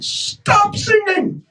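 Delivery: high, frantic yell to stop; panicked shout. Acted. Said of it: "he shouted out to Hanuman".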